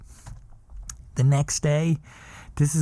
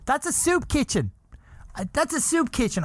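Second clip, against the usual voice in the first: mock rant; 10 semitones higher, 3 dB quieter than usual. Imagined rant at monks.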